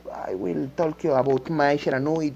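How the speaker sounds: ordinary talk, nothing marked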